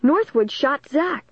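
high, slow, emphatic cry; high-pitched, insistent accusation; accusing cry. A narrator's reading. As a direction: cry out the name high and drawn out. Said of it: "Dennis cried".

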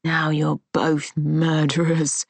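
slow, venomous hiss. A narrator's reading. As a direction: spit the words at her slowly. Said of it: "he hissed".

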